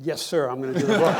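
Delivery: loud laughing reply. Real, not acted. Retold reply, laughing loudly.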